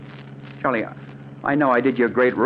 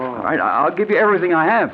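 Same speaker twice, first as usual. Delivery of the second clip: higher, fast, panicky pleading; panicked offer; 5 semitones higher than usual. Acted. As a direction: rush it out in terror. Victim bargains in panic.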